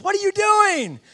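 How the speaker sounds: high, loud hollered question; calling out. Retold or imitated. Old buddy puzzled by the street preacher, loud call. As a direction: shout it across a crowd.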